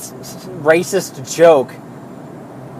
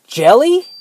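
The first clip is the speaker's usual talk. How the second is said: higher pitch with a sharp rise; incredulous question; 7 semitones higher, 4 dB louder than usual. Retold or imitated. Worker looking back at the pointing customer, incredulous. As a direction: ask it high, in disbelief.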